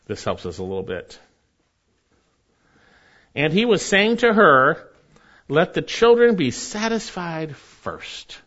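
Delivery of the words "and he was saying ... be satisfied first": loud, high sermon emphasis, slow on the quote; preaching emphatically. Acted. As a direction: preach it out to the congregation. Preaching at full force.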